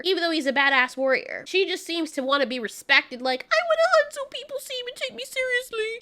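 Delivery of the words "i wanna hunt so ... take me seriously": high, rushed mock-earnest whine. Reviewer mocks heroine wanting to be respected, high whiny voice. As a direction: mimic her pleading in a far higher voice.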